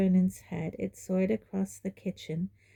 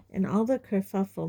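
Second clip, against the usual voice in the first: ordinary delivery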